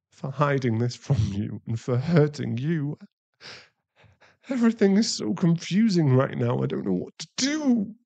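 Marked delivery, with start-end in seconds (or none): 4.5-7.9 rising, cracking despair; fighting back tears, it all spills out; voice breaking. Acted. To his partner after hitting her, voice breaking.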